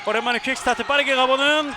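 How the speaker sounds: rising to a big shout; goal call. Real, not acted. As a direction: build up to an excited yell. Fast break opens up.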